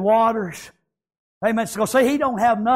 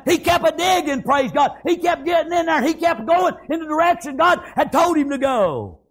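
high, driving revival preacher; high-pitched pulpit shouting; full-force preaching; 7 semitones higher than usual. Real, not acted. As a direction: preach it hard, pitched well up.

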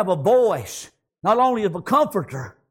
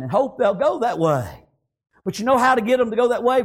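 ordinary talk, nothing marked

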